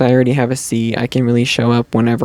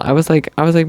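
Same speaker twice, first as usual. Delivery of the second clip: ordinary talk, nothing marked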